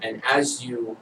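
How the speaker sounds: usual speaking voice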